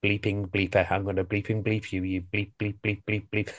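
low, hushed bleeped-out swearing; seething to yourself, low and quiet; muttered inner cursing. Acted. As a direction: mutter censored curses under your breath. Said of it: "deep down he was thinking".

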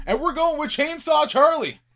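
high and loud reveal; excited announcement. Real, not acted. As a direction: announce it like a big entrance. Announcing the pick with fanfare.

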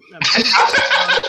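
slow, breathy laughing words. Real, not acted. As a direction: laugh it out slowly. Repeats it while laughing.

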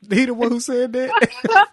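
high, loud, slow laughing question; can barely ask it for laughing; high laughing disbelief. Real, not acted.

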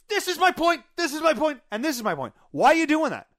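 loud, high, exasperated husband; argumentative yelling. Retold or imitated. Husband arguing with his calm wife, argumentative yelling. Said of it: "with the yelling".

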